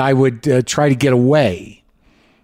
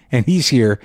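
normal talking voice

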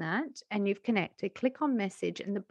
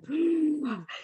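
creepy gasp; 6 semitones higher than usual. Shown as a demonstration. Coach showing listeners how not to start, creepy gasp. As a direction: demonstrate a creepy breath in. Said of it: "is not you going".

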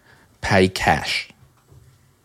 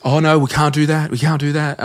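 higher, hammed-up nervous refusal; mock flustered official; 8 semitones higher than usual. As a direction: play the jittery producer saying no. Voicing the audiobook staff refusing.

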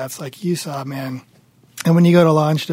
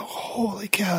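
whisper; 4 semitones higher, 10 dB quieter, slower than usual. Retold or imitated. Inner reaction exclaimed.

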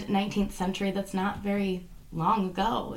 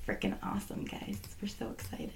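sarcastic whisper; 15 dB quieter than usual. Acted. Deadpan whispered aside after hype.

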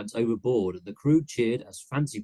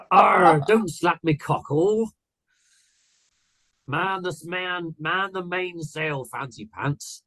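high pirate squeal, drawn out; a hammed-up captain squealing orders; 9 semitones higher, 7 dB louder, slower than usual. Captain squeals orders at his first mate, high pirate voice. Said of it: "squealed the captain".

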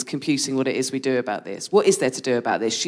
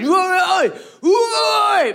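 loud, high-pitched indistinct yell; mock shouting; 4 dB louder, much slower than usual. Comedian imitating a man shouting at someone in a bar, loud high shouting. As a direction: bellow it suddenly, pitched way up. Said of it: "shouting at someone ... it was just really loud".

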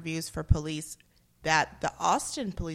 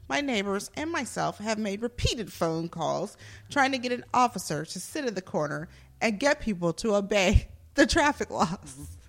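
dramatic reading of a complaint; actor's voice; 5 dB louder than usual. Acted. Host reading police review to co-hosts, actor's voice. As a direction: perform the bad review like an indignant actor. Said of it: "I'm gonna be in my actor's voice".

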